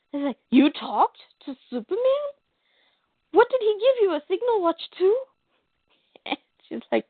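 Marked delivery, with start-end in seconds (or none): 0.5-5.2 higher-pitched, indignant questioning, acted out; mock incredulous. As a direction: imitate someone challenging her in disbelief. Acted quarrel, Clark challenging her.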